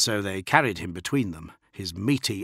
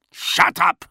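louder snarl; 9 semitones higher, 8 dB louder than usual. A narrator's reading. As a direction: snarl it viciously. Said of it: "the officer snarled".

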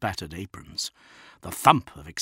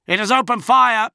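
higher, louder yelled alarm; yelling; 14 semitones higher, 10 dB louder than usual. A narrator's reading. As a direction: yell the warning. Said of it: "the tracking officer yelled".

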